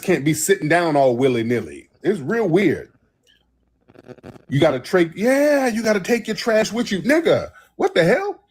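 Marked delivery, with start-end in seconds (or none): 4.5-8.4 pitched way up in disbelief; rising outrage, voice cracking high; high-pitched exasperated rant; incredulous. Real, not acted. Outraged about no trash cans.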